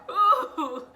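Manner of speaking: high, loud wincing "ooh" half laugh half cry; pained laughing cry. Retold or imitated. Mom retelling delivery, yelping in pain and laughing. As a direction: yelp as the nurse pushes. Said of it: "I said".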